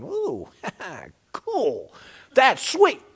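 imitated awed cub, jumping high in pitch; little bear. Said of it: "little bear is going".

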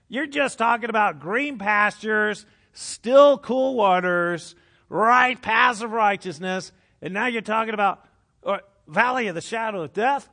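hammed-up indignant questioning; mock disbelief. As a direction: protest in comic disbelief. Mock complaint to God.

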